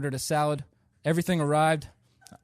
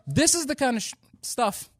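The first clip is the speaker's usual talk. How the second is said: higher and louder, exasperated; exasperated outburst; 10 semitones higher, 4 dB louder than usual. Real, not acted. Comic vents to the audience about being mistaken, exasperated burst. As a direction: burst out in disbelief at the crowd.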